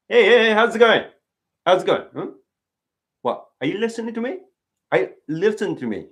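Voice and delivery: high, loud greeting, pestering a friend; loud hey to get attention; rakugo character calling out. Shown as a demonstration. Rakugo character pestering a friend, loud and high. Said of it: "then you would be talking like this".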